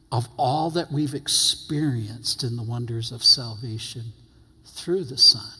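ordinary delivery

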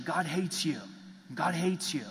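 a hint of a condemning preacher; mock harsh pastor. Pastors preaching wrath at sinners, lightly voiced harsh condemnation. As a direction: flatly hand down judgment. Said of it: "messages of pastors saying".

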